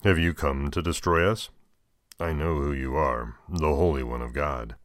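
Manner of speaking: usual speaking voice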